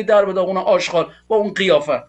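normal talking voice

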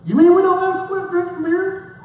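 high, amazed disbelief; surprised question. Retold or imitated. Biker lady amazed she can keep drinking beer, high and surprised. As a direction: squeal it out in disbelief.

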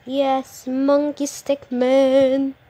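slow loud excited naming; excited announcing. Real, not acted. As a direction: call it out slowly.

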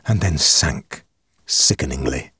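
ordinary delivery, nothing marked

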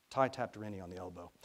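ordinary delivery, nothing marked

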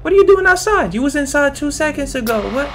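very high squeaky outraged cry; shocked. Real, not acted. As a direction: squeal in disbelief. Zombie suddenly outside.

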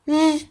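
J as a held buzz, "jjj"; letter sound demo. Shown as a demonstration. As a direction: sound out J with no vowel. (J'yi "jjj" diye uzat)

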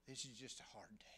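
soft breathy whisper. Real, not acted. Whispered to a hurting viewer. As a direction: hush it like a secret. Devotional message, whispered aside.

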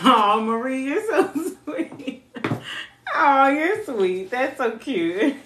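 touched and flattered. Real, not acted. Reader thanking viewer Marie, gushing and laughing. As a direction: melt at the compliment.

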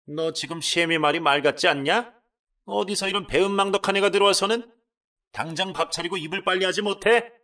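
loud, high furious mother-in-law; shrill-free hammered scolding shout; angry scolding. Acted. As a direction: bark down at the daughter-in-law in rage. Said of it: "화를 내시기 시작하셨답니다".

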